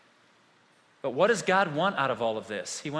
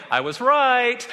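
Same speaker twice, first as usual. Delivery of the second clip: gleeful told-you-so; 6 semitones higher, 7 dB louder, slower than usual. Retold or imitated. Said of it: "someone said this morning".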